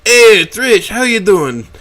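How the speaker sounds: loud jolly boss bellowing hello. A narrator's reading. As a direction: boom it out like a jovial host. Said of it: "The jolly Tyrannosaurus bellows".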